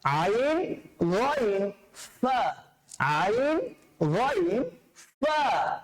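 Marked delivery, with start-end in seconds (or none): none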